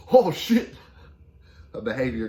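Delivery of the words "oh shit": high, loud blurt of amazement; high-pitched mock astonishment; mock shocked outburst. Acting the giraffe's reaction to scent.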